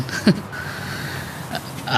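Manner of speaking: a breathy little laugh between lines; soft laugh. Acted. Amused at the talk, soft breathy laugh. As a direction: laugh lightly at the memory. Lighthearted chat over dinner.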